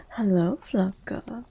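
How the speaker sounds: ordinary delivery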